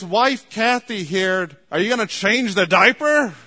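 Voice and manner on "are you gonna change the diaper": husband calling out. Retold or imitated.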